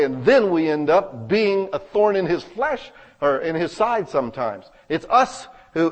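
ordinary talk

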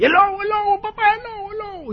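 falsete agudísimo que se va apagando; niño gritando alarma. Acted. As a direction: imita a un niño pidiendo socorro. Niño repite falsa alarma. (grito fingido de niño, muy agudo)

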